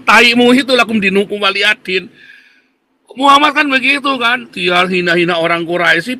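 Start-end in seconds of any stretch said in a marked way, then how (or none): 0.0-2.1 "lakum dinukum waliyadin" spat back with contempt, less high; scornful retort. Real, not acted. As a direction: throw the phrase back at them in disgust.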